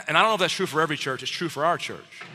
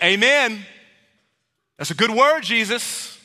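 mock enthusiastic amen; 5 semitones higher, 5 dB louder, slower than usual. Listener loving a favored teaching.

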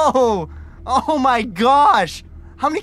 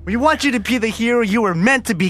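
usual speaking voice

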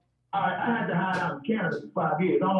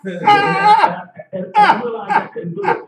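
laughing loudly through the words; loud laugh-filled confession; 11 semitones higher, 10 dB louder than usual. Real, not acted. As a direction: laugh while admitting defeat. Laughing at his failed plan.

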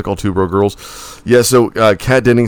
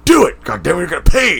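high, shouted mock order; bully him with an exasperated shout; angry mock shout; 9 semitones higher than usual. Producer pressuring a reluctant actor to do the accent, angry mock shout.

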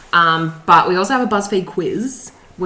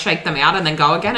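usual speaking voice

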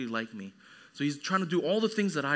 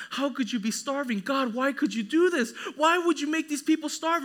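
high, increasingly loud appeal to God; hammed-up outcry to heaven; mock lament; 9 semitones higher, 4 dB louder than usual.

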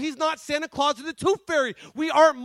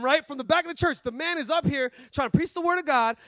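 ordinary talk, nothing marked

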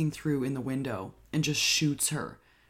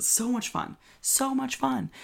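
gushing; 11 semitones higher than usual. Real, not acted. Enthusing about film.

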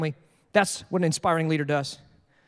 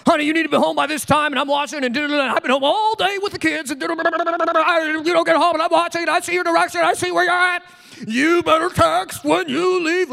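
high, nagging mock wife; demanding wife; 8 semitones higher than usual. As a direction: play a bossy nagging wife, pitched up. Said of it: "or do you demand it?".

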